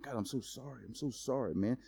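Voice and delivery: soft, hushed, grovelling sorry; mock ashamed whisper. Believer mutters sorry to God in mock shame.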